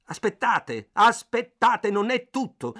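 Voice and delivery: interrupting the clerk to tease him, loud; loud and provoking. A narrator's reading. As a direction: shout him down to needle him. Narrator cuts in to provoke clerk.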